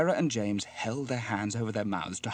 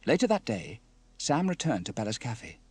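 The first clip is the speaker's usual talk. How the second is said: plain reading voice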